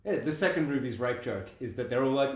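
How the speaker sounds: ordinary talk, nothing marked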